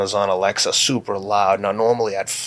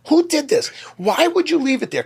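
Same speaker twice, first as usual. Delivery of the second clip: much higher, exasperated mock scolding; exasperated dad scolding. Dad scolding his kids over juice left out, exasperated and much higher. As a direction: retell your own fed-up outburst, pitched way up.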